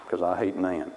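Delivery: low, mock-sulky boy; sullen anger. Boy tells mother about his sister, low mock sulk. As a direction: grumble it low as a jealous child. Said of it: "Why are you angry?".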